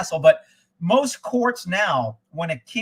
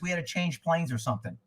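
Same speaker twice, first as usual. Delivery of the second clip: ordinary delivery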